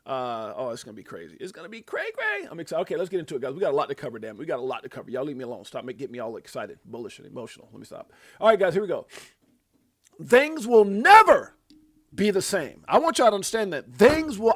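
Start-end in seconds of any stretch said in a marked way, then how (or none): 1.4-2.5 very high silly voice; goofy high-pitched excitement; playful squeal. Real, not acted. Playful repeat of "gonna be crazy. 10.2-12.8 "never" stretched out high and loud; slow, booming proclamation peaking on "never"; heavy stress on "never". Real, not acted. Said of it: "I can't even stress the word never". 13.9-14.5 loud, high, insistent "things will"; emphatic restart. Real, not acted. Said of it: "I can't even stress the word never".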